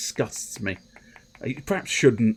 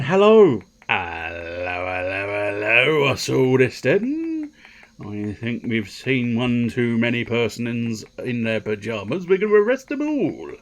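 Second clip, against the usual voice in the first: drawn-out bobby's hello, swooping upward; hammed-up copper making an arrest; comic policeman. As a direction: play a pompous policeman, slowly. Put-on comic policeman routine.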